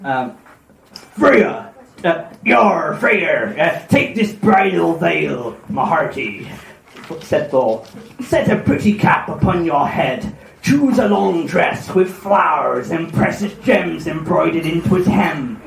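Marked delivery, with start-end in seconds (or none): 1.2-6.5 slow Cornish sea-dog; Cornish pirate accent. Acted. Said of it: "it sounds very Cornish now". 8.2-15.6 put-on Cornish pirate accent. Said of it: "it sounds very Cornish now".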